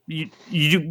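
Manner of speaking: ordinary delivery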